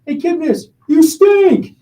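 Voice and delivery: high, loud mock kid taunt; little fan taunting the player, loud high mock kid voice; kid heckling. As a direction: heckle him like a cheeky eight-year-old. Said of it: "And he's like".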